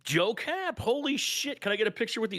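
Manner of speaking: high-pitched starstruck gush; squeaky thrilled disbelief; excited fan. Retold or imitated. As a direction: gush like a fan meeting his hero. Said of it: "And I'm like".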